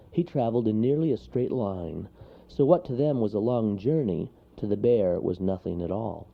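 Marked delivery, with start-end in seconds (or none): none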